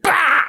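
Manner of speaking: high explosive laugh sound; burst of laughter. Retold or imitated. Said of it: "she immediately was like".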